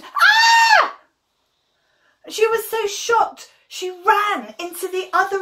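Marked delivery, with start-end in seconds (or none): 0.0-1.0 high, loud startled scream. Acted. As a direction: shriek in shock. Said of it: "Rhoda screamed".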